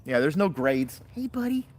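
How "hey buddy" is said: pet talk. Real, not acted. Owner cooing hello to her dog.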